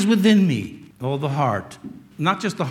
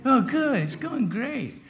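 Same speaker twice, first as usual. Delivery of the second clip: high-pitched fake-cheerful reply; put-on chirpy politeness; phony cheer; 8 semitones higher, 3 dB quieter, slower than usual. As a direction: pretend it's all great, trailing off softer. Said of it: "everybody knows is phony".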